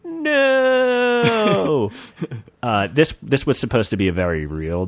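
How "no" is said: high, drawn-out no; falling cry. Acted. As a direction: cry no as he falls. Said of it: "he said".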